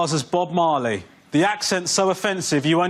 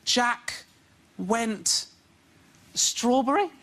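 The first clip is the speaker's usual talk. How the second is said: put-on higher, halting child reading aloud; 6 semitones higher, much slower than usual. Son reading his book aloud, halting and higher. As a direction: stumble through it like a small boy guessing. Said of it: "when my son goes".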